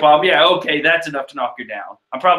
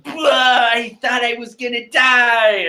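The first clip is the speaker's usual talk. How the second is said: excited Chopper; 7 semitones higher than usual. Acted. Chopper relieved after rescue.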